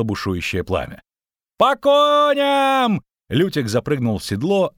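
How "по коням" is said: very high, loud, slow shout; hoarse battle cry. Acted. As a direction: bellow the call to mount up. Calling everyone to ride.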